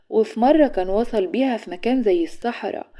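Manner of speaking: ordinary talk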